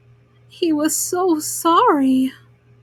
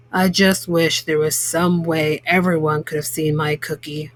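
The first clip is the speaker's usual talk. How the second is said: lower, measured baker forgiving the dog; 9 semitones lower than usual. A narrator's reading. Baker forgiving the dog, lower and measured. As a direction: think it over and let it go. Mr Bleakman wishing aloud.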